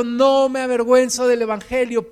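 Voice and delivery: declara en voz alta, con fuerza; proclamación fuerte. Real, not acted. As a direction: proclama el versículo al público. Proclama la cita bíblica. (lo proclama con fuerza, en voz alta)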